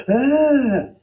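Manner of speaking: sudden shrill scream of fright; high startled yell. Acted. Father scared by painted face, high startled scream. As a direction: yelp in terror. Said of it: "His father yelled".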